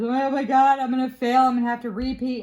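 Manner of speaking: high, loud, rushed panic; panicked tearful quote. Retold or imitated. Student telling the professor she'll fail, in a panic. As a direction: blurt it out like you're about to fail.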